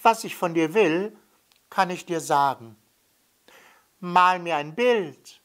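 put-on high child's voice, insistent; kleiner Junge. A narrator's reading. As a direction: voice the little boy, bright and high. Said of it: "antwortete der kleine blonde Junge". (hohe Kinderstimme, beharrlich)

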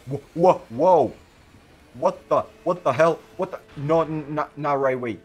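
real surprised outburst; startled. Real, not acted. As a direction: caught off guard, shout it out. Character suddenly thrown, startled.

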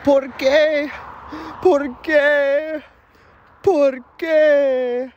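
high, wailing cry of grief; hammed-up sobbing "why"; wailing widow. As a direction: wail it like a grieving widow. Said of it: "she is saying in Spanish".

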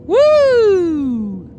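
cheering his driving; high triumphant whoop. Real, not acted. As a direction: let it ring out in triumph.

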